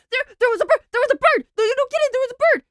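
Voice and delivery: mock protest that it really was a bird; hammed-up indignant insisting; insistent friend. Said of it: "Oppo was like".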